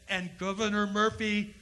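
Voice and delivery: normal talking voice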